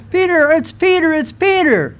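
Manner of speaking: high-pitched excited retold cry. Woman running back to tell the others Peter is there, excited. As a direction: blurt it out, pitch leaping up on each name. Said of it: "she's so excited".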